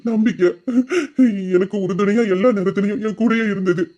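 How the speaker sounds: ordinary talk, nothing marked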